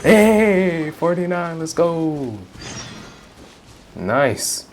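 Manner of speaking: normal talking voice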